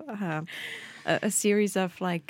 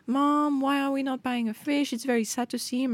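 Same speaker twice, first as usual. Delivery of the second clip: put-on higher pleading child voice, lightly done; imitating daughter; 6 semitones higher than usual. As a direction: mimic a sad kid begging mom. Daughter pleading in retold story.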